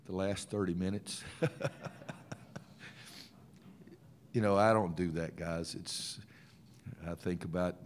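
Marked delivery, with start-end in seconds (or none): 1.3-2.6 short run of breathy laughter. Real, not acted. Coach joking to reporters about retirement, laughing. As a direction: chuckle after his own joke.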